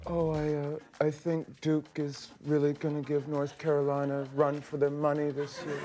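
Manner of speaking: John Malkovich impression. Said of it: "a John Malkovich impression".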